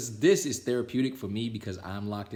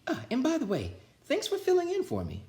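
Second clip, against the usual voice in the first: much higher, teasing; playful thanks. A narrator's reading. Miss Nelson thanks her sister, high playful thanks. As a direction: share the joke.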